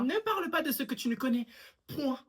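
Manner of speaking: put-on high, loud old-lady rebuke; scolding old lady. Old woman scolding the teenager, loud and high. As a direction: snap back as a stern elder.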